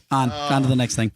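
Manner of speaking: ordinary talk, nothing marked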